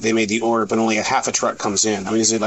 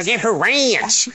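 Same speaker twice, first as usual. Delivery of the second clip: mock Southern drawl, slow and high; lightly hammed-up Southern ranch; imitating southern accent; 11 semitones higher, much slower than usual. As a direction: stretch ranch out Southern style. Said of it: "You and that southern accent".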